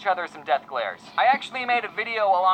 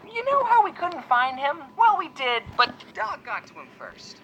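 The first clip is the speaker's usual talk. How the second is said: quoting mom; 6 semitones higher than usual. Retold or imitated. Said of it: "She said".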